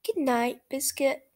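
lively read-aloud for small listeners; reading to children. A narrator's reading. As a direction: read it brightly to little kids. Reads picture book to children.

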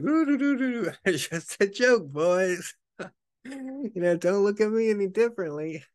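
high, laughing, awkward brush-off; giggly nervous cover. Retold or imitated. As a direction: hum it off like nothing happened.

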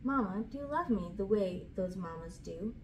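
ordinary delivery, nothing marked

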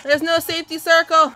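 ordinary delivery

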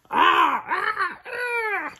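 very high "ah"s, loud then easing; high cartoon cry. Narrator voices the cave scene, high squealed cries. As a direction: let out squealed ah, ah, ah. Sneeze building on the page.

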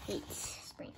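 breathy whispered aside. Real, not acted. Kid whispering a reminder to the viewers, whispered. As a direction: whisper it under your breath.

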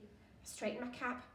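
usual speaking voice

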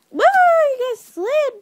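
high, loud, drawn-out exclamation; startled whoop. Real, not acted. Streamer to viewers as they slide, startled loud whoop. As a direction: whoop as things suddenly slip.

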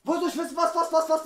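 heated complaint while building the goal; angry shout. Real, not acted. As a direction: snap back at him loudly. Quarrel over the goal.

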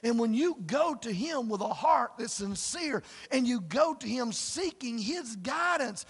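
usual speaking voice

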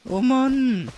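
high startled yelp; startled shout. Real, not acted. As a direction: jump at the sudden scare. Startled in game.

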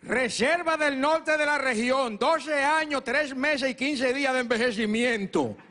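viejito catador, más agudo y lento. Acted. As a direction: presume cada detalle como experto en vinos. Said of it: "mi abuelo hizo así". (viejito catador presumido, lento y agudo)

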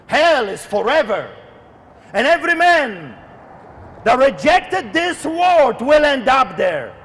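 forceful warning, hammering the key words; fiery preaching. Real, not acted. Preacher warns listeners of coming judgment, fiery preaching. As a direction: preach judgment with long pauses between cries.

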